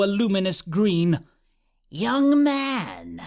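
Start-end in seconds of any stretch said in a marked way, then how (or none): 1.9-3.2 high, eerie whispery call; Chaos Witch. A narrator's reading. Witch summons Oxwald from the cup, eerie whisper. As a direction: draw it out like a spectral witch. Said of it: "her voice like a thousand whispers".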